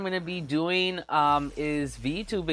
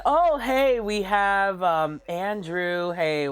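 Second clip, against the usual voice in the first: ordinary talk, nothing marked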